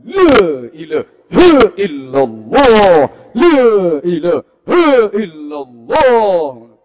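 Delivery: very loud, slow, swooping chant; wildly enthusiastic tahlil. Acted. Guests eyeing a big food parcel, wildly enthusiastic tahlil. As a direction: belt out each syllable with exaggerated zeal. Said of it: "Tahlil ya semangate ra karuan".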